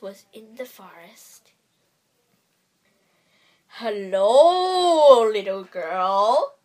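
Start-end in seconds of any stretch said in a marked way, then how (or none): 3.8-6.6 low, slow growling wolf voice; deep growly wolf. A narrator's reading. Wolf greets the little girl in the forest, deep and growly. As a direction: growl it out like a big bad wolf. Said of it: "said a deep, growly voice".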